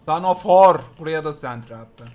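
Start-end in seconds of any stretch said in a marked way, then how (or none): 0.0-0.8 shouted curse, much louder. Real, not acted. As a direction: bark the curse out loud. Cursing loudly at game. (yüksek sesle küfür)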